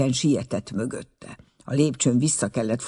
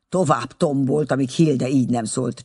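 ordinary delivery, nothing marked

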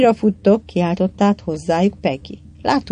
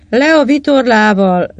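loud, raised-pitch order; captain's command, louder and higher; 5 semitones higher, 6 dB louder than usual. A narrator's reading. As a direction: give the crew an order. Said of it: "vezényelt Susan".

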